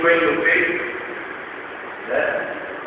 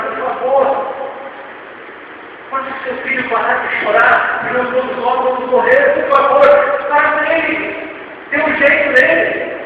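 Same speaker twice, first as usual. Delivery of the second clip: raised, urgent begging, not hushed; frightened pleading; 9 semitones higher, 3 dB louder than usual. Retold or imitated. Passengers beg the mother on the boat, frightened plea. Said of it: "todo mundo baixinho começou a falar assim".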